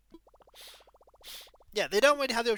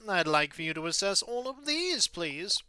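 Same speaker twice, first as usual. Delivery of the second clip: ordinary talk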